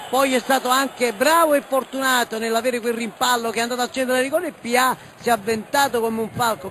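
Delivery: ordinary talk, nothing marked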